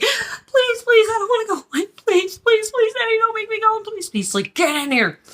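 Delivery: very high, tearful little-kid wail, imitated; crying child begging. Little girl begging Daddy not to make her go, crying. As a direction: act out a sobbing child begging frantically. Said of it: "I was crying. I'm like".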